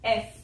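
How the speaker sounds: "एफ" said as eff, open e then f; correct F. Shown as a demonstration. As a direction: say "एफ" clearly as the letter F. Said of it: "हमें बोलना है".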